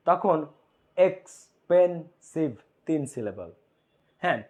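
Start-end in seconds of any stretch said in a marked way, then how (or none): 1.0-2.5 এক্সপেন্সিভ drawn out, syllables apart; syllable demo. Shown as a demonstration. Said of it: "যখন তুমি বলতেছো এক্সপেন্সিভ". (এক্সপেন্সিভ syllable ভেঙে উচ্চারণ)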